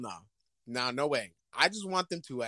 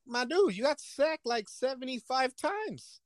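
high mock owner complaint; 9 semitones higher, slower than usual.

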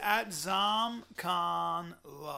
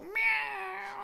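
cat meow. Acted. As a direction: purr out a silly cat call. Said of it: "go up to girls and go".

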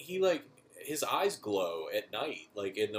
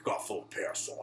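put-on trader voice, losing its voicing at the end; merchant impression. Player voicing the merchant explaining his gun habit, put-on role voice. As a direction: play the parasite-ridden gun dealer. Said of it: "He's like".

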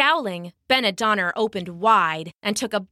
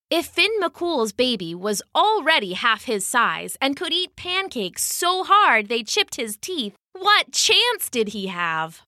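plain reading voice

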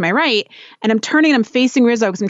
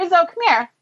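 high, coaxing call to a pet; calling a dog; 8 semitones higher than usual. Real, not acted. Owner calling new dog Rizzo over, high coaxing call. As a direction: call the puppy over in a higher voice.

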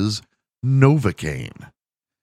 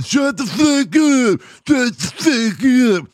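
much higher, slow, slurred shout through a numb mouth; numb-mouthed yell; 5 dB louder, slower than usual. Retold or imitated. Numb-mouthed man yells at laughing friends, slurred shout. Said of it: "I'm going".

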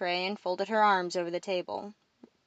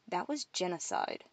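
softer, grave statement; serious; 8 dB quieter than usual. A narrator's reading. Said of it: "changed from jovial to serious".

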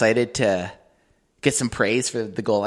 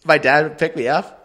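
ordinary talk, nothing marked

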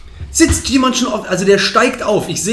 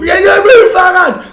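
much higher, louder, slow, wailing; mock sobbing shout; 9 dB louder, slower than usual. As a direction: cry out for the stolen bike like a sobbing kid. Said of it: "angefangen zu weinen. Im Laufen". (gespielt schluchzend und schreiend um das Fahrrad)

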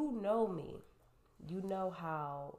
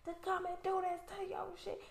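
much higher, scornful cussing voice; cussing neighbor. Retold or imitated. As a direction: spit out the insult like an outraged neighbor. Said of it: "She's on the phone cussing".

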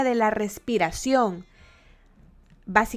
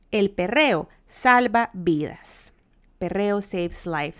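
slow slogan; much slower than usual. Acted. Proclaiming a slogan.

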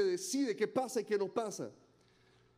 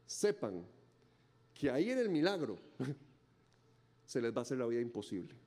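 voice of the King; 7 semitones lower than usual. Acted. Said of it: "el Rey de Reyes ... les dice".

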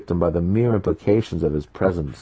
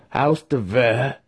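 mocking; 6 semitones higher, slower than usual. A narrator's reading. Master mocks the name.

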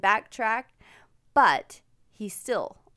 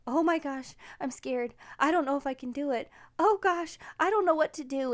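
higher-pitched mock-nervous child; lightly voiced worried boy, pitched up; nervous little boy; 6 semitones higher than usual. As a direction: imitate an anxious kid talking himself through it. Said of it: "he was so nervous".

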